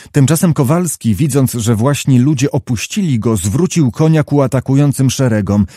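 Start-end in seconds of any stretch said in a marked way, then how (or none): none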